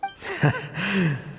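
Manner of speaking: a short embarrassed chuckle; sheepish laugh. Acted. Chief laughing at his own ignorance, sheepish chuckle. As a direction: laugh it off at yourself, breathy and light.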